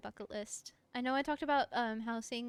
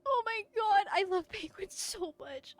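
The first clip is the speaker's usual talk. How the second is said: gushing; 7 semitones higher, 5 dB louder than usual. Real, not acted. Gushing over penguins.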